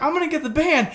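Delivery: squeaky impression of a buddy; high mock voice. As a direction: voice jumps way up, imitating him. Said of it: "Lawrence was like".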